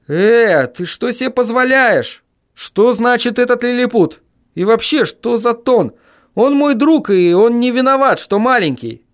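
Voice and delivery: high, heated telling-off; high-pitched indignant defence. A narrator's reading. Acted quarrel, narrator scolding caller.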